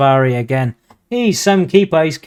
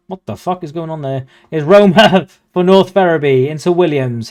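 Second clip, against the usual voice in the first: ordinary delivery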